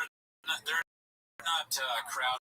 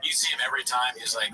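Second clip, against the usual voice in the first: ordinary delivery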